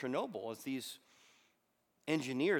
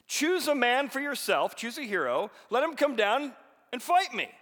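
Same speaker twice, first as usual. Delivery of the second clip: Goliath's challenge; 11 semitones higher, 9 dB louder than usual. Acted. Giant daring Israel to send a champion, big shout. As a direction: bellow the dare to the enemy line. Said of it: "cried out to all the army".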